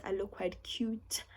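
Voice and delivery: soft, unvoiced, slow little boast; a quiet proud aside to viewers; whispered aside. Real, not acted. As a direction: whisper it like a secret brag. Aside about her own look.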